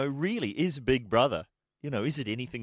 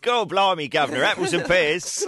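hammed-up louder fake cockney; mock movie cockney, playing it big; 7 semitones higher, 8 dB louder than usual. Host jokes a fake cockney line on air.

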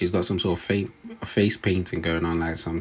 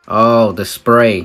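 loud exclamation; 3 semitones higher, 12 dB louder, much slower than usual. Real, not acted. Exclaims about the spray.

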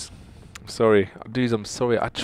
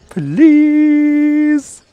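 very high, long, loud wail; scream-beg in one held note; desperate plea; 12 dB louder than usual. Real, not acted. Desperate begging for four star.

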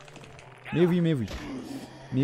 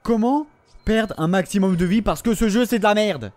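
angry rant; 5 semitones higher, 4 dB louder than usual. Real, not acted. Ranting at the game after losing.

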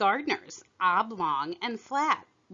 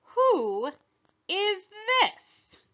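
a stern demand, pitched sharply higher; the queen demands sternly, rising high on this; severe; 12 semitones higher, slower than usual. Acted. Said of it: "the queen said severely".

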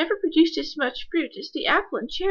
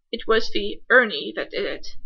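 plain reading voice

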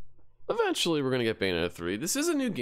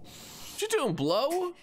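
mock voice; much slower than usual. Mocking game sister's line.

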